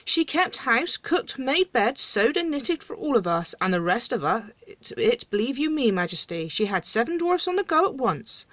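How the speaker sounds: high-pitched, insistent dwarf voice; protesting storybook dwarf. Acted.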